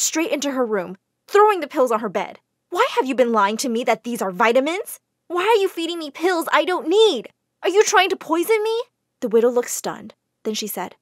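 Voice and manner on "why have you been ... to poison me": rising, louder accusing; angry accusation. A narrator's reading. Jane confronting the widow, angry. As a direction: demand answers.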